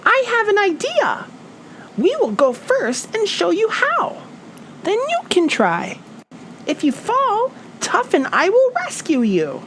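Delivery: a tiny bird squeaking with excitement; Fluff the bird. A narrator's reading. Fluff urging her friends, high bird voice. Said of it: "Fluff said".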